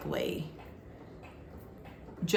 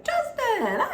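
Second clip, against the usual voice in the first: very high, bright call of the name; imitating Rodney's greeting. Rodney greeting Justin, mock cheerful. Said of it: "Even Rodney was like".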